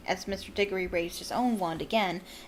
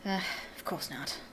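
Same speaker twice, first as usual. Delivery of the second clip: much softer embarrassed mumble; mumbled; 2 semitones lower, 10 dB quieter than usual. A narrator's reading. Diggory backs down before Mister Weasley, mumbled. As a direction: mutter it, backing down. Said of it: "mumbled Mister Diggory".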